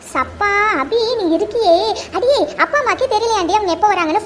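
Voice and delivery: exasperated giggling scold; laughing exasperation. Acted. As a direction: laugh at her cluelessness.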